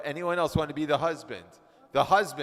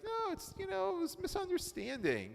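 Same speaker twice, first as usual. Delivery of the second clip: mimicking Ernie; 6 dB quieter than usual. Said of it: "Ernie was like".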